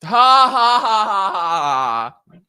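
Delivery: loud, spelled-out sarcastic ha ha; sarcastic fake ha ha; fake laugh. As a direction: read the laugh out flatly to mock. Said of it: "fake laugh at joke".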